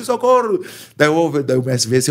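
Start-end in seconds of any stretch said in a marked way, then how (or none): 0.0-0.6 high mock Spanish yelp; Spanish-accented cry for help. Fighter recalling the Spaniard's fright, high Spanish-accented cry. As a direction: throw the voice up like a scared Spaniard. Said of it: "em espanhol".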